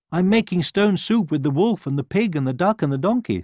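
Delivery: high bright hen; happily listing friends; cheerful hen. A narrator's reading. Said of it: "said the hen".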